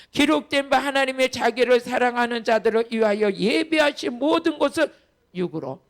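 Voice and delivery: loud, steady recitation of the verse; loud scripture reading. Acted. Pastor leading the congregation through the verse, loud. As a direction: read it out together, full voice. Reads verse again aloud.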